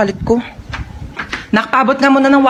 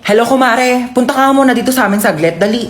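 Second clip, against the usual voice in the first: ordinary talk, nothing marked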